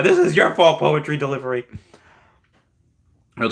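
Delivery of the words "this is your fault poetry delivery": high, loud creepy character voice; hammed-up creepy delivery, loud and high; creepy put-on voice. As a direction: push it up into a creepy, silly pitch. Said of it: "This, this creepy [name] speaking like this".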